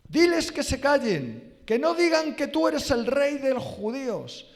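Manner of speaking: high, loud, insistent demand; judíos urging Jesus, annoyed and insistent; complaining judíos. Retold or imitated. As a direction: press the Lord hard to silence them. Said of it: "le dijeron unos judíos al Señor".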